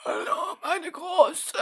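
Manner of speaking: high, quavering dying grandpa voice; dying old man voice. Pretend. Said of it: "genauso klingen die neunundsechzigjährigen".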